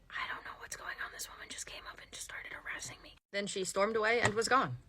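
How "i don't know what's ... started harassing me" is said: hushed aside into the phone; acted whispered complaint; confiding whisper. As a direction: whisper it, baffled. Manager confides into phone after rant.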